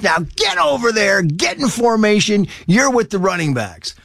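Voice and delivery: high, strained order without much added volume; imitated coach's yell. Coach ordering a player from forty yards, mock yell. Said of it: "screaming at me to get in formation".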